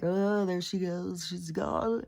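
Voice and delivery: slow, hammed-up wail for the dogs; drawn-out mock lament; mock crying. Voicing the dogs at her imagined death, mock crying. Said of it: "They start crying and panicking".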